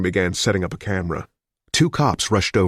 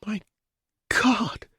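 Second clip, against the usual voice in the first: said sickly; 14 semitones higher, 4 dB quieter than usual. A narrator's reading. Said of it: "Amelia said sickly".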